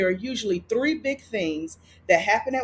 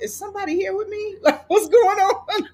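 scared; 11 semitones higher, 5 dB louder than usual. Retold or imitated. Scared on waking, asks.

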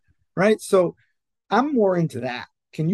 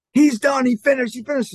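higher and louder, imitating a thrilled dad; excited dad on phone; 9 semitones higher, 5 dB louder than usual. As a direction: blurt it out like the race just ended. Dad on phone as son runs.

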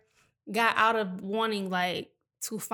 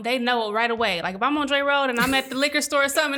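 usual speaking voice

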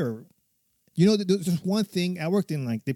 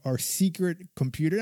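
ordinary talk

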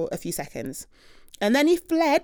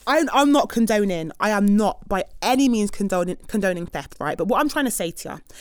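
ordinary talk, nothing marked

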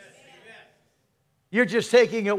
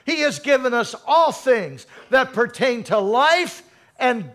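louder, driving preacher's cadence; fervent preaching; 4 semitones higher, 4 dB louder than usual. Acted. Preacher insisting God already gave everything, loud and driving. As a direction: press the point home with fire.

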